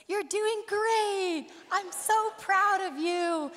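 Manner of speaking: high, slow, gushing shout-out; mock cheering stranger. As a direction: gush like an overeager stranger cheering. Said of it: "and he goes".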